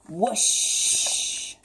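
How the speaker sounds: long, hissed-out whoosh; whoosh sound effect. A narrator's reading. Narrator voicing Octopus speeding past, whoosh sound effect. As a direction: stretch the whoosh into rushing water. Imitating rushing through water.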